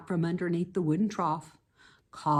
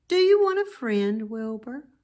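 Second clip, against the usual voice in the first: lightly voiced, higher gentle offer; thin pleasant voice; 5 semitones higher, 5 dB louder than usual. The unseen voice offering Wilbur friendship, gentle. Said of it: "small voice ... thin, but pleasant".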